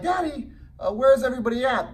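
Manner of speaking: put-on high, excited child asking his dad; little boy voice. Son asking his dad at the empty park, high child voice.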